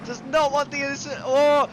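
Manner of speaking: high, fast, excited race call; motorsport commentator. Real, not acted. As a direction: build the call as he heads for the wall. Live call of side-by-side fight.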